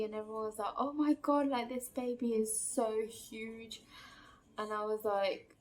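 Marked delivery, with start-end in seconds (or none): 0.8-3.8 amazed staff. Retold or imitated.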